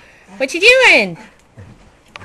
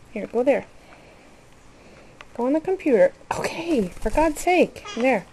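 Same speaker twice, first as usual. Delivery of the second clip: ordinary talk, nothing marked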